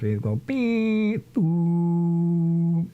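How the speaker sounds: high, slow electronic beep-boop; TV beep imitation. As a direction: beep like an old TV switching off. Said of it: "the T V would actually turn off".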